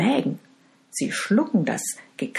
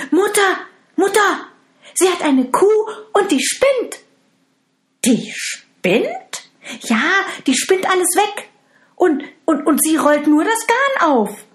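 much higher and louder, breathless tattletale; excited tattling stepsister; 13 semitones higher, 6 dB louder than usual. Acted. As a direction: rush to tell mother, voice up high.

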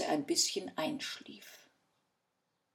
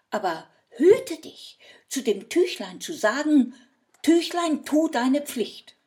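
raised, urgent warning to the innkeeper; loud warning; 8 semitones higher, 12 dB louder than usual. Acted. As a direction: call it out high and loud as a stern warning. Husband warns innkeeper, shouted.

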